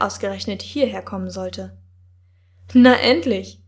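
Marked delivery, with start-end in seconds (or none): 2.7-3.6 loud, eager call of relief; louder excited exclamation. A narrator's reading. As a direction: call out to a boyfriend, glad it's finally time. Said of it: "rief plötzlich eine weibliche Stimme".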